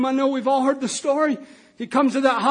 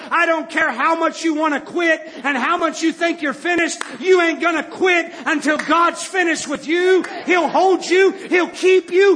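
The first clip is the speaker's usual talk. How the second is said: driving, emphatic sermon delivery; fervent preaching; 3 semitones higher, 3 dB louder than usual. Acted. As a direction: preach it hard with a rising build. Preaches at full force.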